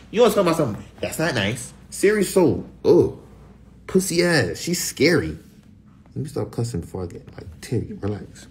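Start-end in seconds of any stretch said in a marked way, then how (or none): none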